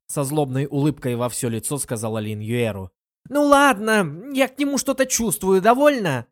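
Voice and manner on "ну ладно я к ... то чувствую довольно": loud admission. A narrator's reading. Murong Qiu finally loudly admits her feelings, exasperated. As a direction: blurt it out loudly, giving in. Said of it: "признала громко".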